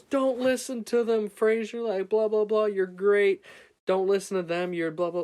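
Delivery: high, loud mock cheering; shouted encouragement. Kid standing up in the crowd for the pitcher, imitated shouting. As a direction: yell support like a devoted young fan.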